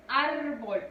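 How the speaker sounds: "Árbol" split in two, weight on ÁR; syllable-by-syllable "Árbol", first one strongest; stressed first syllable. Shown as a demonstration. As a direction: stretch the ÁR of "Árbol", then let BOL fall. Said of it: "recae ahí la mayor fuerza de voz".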